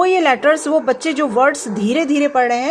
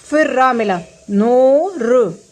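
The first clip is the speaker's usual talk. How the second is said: slow sounding out; much slower than usual. Shown as a demonstration. Teacher to parent, showing how to split a word, slow. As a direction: stretch out नोर slowly, syllable by syllable. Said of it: "इसको कई टुकड़ों में तोड़ देना है".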